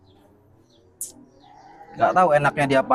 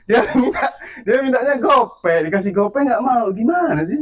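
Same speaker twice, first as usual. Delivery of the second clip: high-pitched, exasperated protest; incredulous complaint; 9 semitones higher than usual. Real, not acted. Man whose payment the preman refused, incredulous. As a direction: voice shoots up in amused disbelief.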